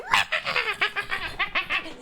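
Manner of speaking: high, rattling gremlin noise; gremlin cackle. Acted. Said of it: "Gremlin sign, like".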